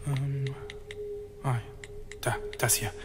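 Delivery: leise flüsternd, kaum Stimme; flüstere es vorsichtig im Dunkeln. Acted. Flüstert im dunklen Tunnel. (leise geflüstert)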